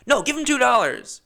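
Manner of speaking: high, loud outburst; emphatic rebuttal. Acted. Driver rebuts a stingy rider, high loud protest. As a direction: overrule the excuse loudly.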